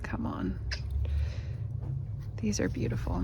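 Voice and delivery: soft awed whisper. Real, not acted. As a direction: breathe it out in awe. Whispering over ornaments.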